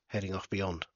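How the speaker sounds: ordinary delivery, nothing marked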